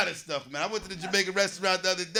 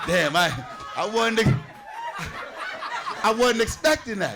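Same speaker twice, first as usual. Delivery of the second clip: flustered laughing; 4 semitones higher than usual. Retold or imitated. His flustered reply in story.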